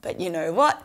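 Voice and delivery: ordinary delivery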